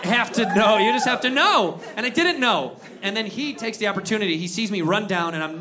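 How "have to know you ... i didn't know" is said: raised, loud comic protest; worked-up, higher and louder; exasperated rant. Acted. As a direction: protest it loudly to the crowd.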